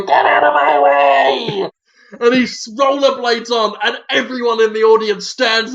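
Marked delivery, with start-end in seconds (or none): 0.0-1.7 hammed-up slow angry yell; a big put-on shout clearing the way; mock shout. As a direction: bellow it as Willem Dafoe, drawn out. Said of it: "Willem Dafoe go".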